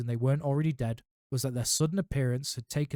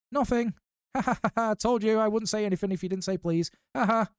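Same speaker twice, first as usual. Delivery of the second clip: very high gleeful cackling taunt; cackling poltergeist; 9 semitones higher, 4 dB louder than usual. A narrator's reading. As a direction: gloat with a cackle. Peeves cackling at Filch.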